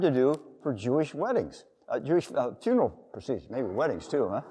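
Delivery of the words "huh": ordinary delivery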